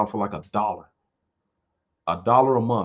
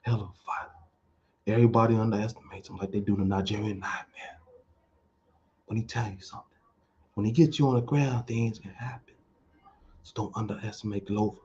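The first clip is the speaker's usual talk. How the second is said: Mayweather impression; 4 dB quieter than usual. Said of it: "he started talking like Mayweather".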